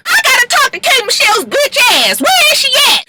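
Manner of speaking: very high, shrill yelling demand; mock enraged mother. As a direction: hunt down the woman who wronged her daughter. [name] demanding to see [name].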